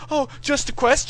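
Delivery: high, loud mocking repeat; mocking echo. [name] mocks the "just a quest" excuse, high and loud. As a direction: throw the excuse back in mockery.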